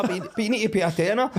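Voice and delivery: mock demand. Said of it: "they turn around like".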